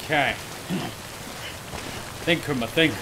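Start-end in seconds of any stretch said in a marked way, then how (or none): none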